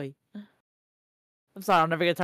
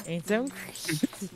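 slow drawn-out echo; 4 dB quieter, much slower than usual. Pretend. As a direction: stretch the words out slowly. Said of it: "echoes the drawn-out line".

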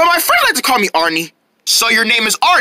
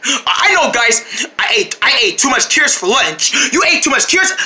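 ordinary talk

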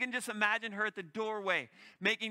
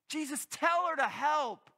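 high, louder, pleading complaint; exasperated plea; 8 semitones higher, 5 dB louder than usual. Acted. Martha complaining to Jesus about her sister, exasperated high plea. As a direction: beg Jesus to make her sister help. Exclamation mark.

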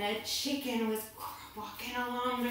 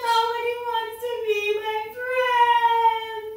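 loud, high mock bawling; hammed-up tearful howl; crying snake; 9 semitones higher, 7 dB louder than usual. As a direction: wail it like an inconsolable little snake. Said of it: "the snake cried even louder".

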